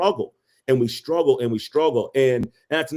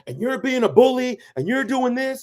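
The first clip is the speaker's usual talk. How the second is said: higher-pitched mock tirade; nagging partner; 8 semitones higher than usual. Imitating her protesting as he walks away, mock indignant. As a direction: pile up accusations as an upset girlfriend. Mimics partner's angry accusations.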